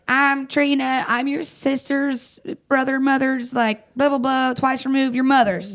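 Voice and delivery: high-pitched mock introduction; gushing relative imitation. Unknown relative phones grieving daughter, high mock chatter. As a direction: mimic a distant relative rambling through the family tree.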